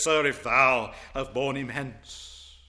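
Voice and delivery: slow, a hint of accusation; accusatory tone. Mary faintly accusing the supposed gardener, slow. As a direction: lightly accuse the stranger, slowly. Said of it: "in an almost accusatory tone".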